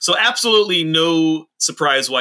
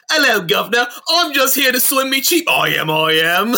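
hammed-up Cockney chimney sweep, higher and louder; playful high Cockney voice, louder; Cockney accent; 8 semitones higher, 3 dB louder than usual. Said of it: "a Cockney accent".

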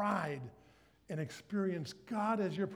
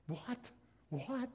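softer, higher startled what; can't believe the ask; shocked; 6 semitones higher, 5 dB quieter than usual. Retold or imitated. Young him told to give first, startled.

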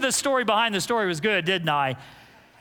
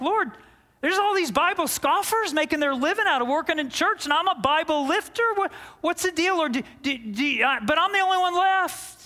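mock self-pity; 7 semitones higher than usual. Preacher complaining to God.

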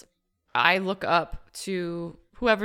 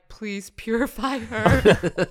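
drawn-out, louder mock plea; pleading prayer; 5 dB louder, slower than usual. Cleric silently praying as the staff comes down, pleading. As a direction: beg for it slowly, half laughing. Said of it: "truly in my mind being like".

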